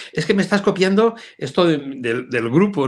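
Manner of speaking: high, pushed-up voice of an aggrieved member; mock complaining member. Imitating a member accusing him of copying the group, in a high complaining voice.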